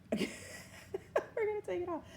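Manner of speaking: high, slow, laughing delight; giggly high-pitched triumph. Real, not acted. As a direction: laugh through it with glee.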